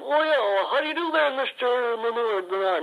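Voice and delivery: a dummy mangling a name; Horatio dummy voice. Acted. Greeting Monsieur Bernard, Horatio squeaks high. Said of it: "Now, you say hello to him".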